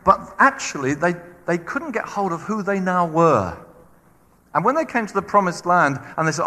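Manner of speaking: ordinary talk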